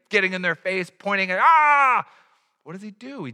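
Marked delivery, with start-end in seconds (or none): 1.4-2.0 high loud accusing yell; mock mob shout. Angry mob pointing at the woman, mock shouting. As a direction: bark it like a pointing angry crowd.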